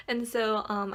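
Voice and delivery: ordinary delivery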